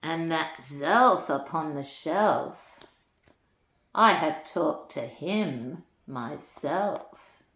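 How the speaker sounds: lilting storybook read-aloud; reading to children. A narrator's reading. Granny reading a rhyming book to children, lilting. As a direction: read the rhymes to little ones with relish.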